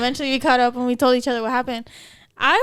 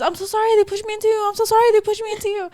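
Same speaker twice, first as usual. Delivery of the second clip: high, fast panicked apology; rushed, high-pitched mock pleading; freaking-out apologizer; 7 semitones higher, faster than usual. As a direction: imitate a stranger apologizing in a fluster. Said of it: "you're just freaking out".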